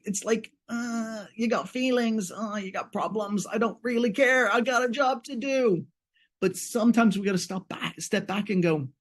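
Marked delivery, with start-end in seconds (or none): 0.0-5.9 higher, dismissive shrug of a voice; uncaring lawyer. Retold or imitated. Lawyer brushing off a client's feelings, dismissive. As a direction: brush off their feelings, bored and high.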